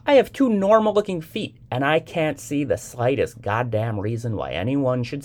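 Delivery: high, loud, angry complaint; indignant rant. A narrator's reading. Young man ranting after the woman leaves, indignant. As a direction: rant in outraged protest. Man rants about his feet.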